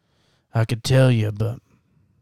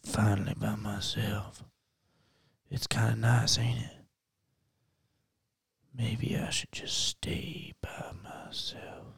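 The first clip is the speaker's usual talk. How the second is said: whispered musing; 12 dB quieter, slower than usual. Acted. Alone in woods musing.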